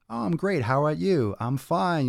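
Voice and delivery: high, sing-song polite chatter; a light mock of polite small talk; chirpy small talk. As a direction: parody cheerful small talk.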